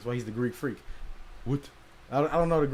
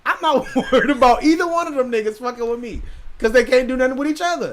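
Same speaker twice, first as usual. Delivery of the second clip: laughing; 10 semitones higher, 8 dB louder than usual. Real, not acted. Laughing while boasting about fights.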